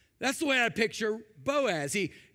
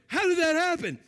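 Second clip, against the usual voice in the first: ordinary talk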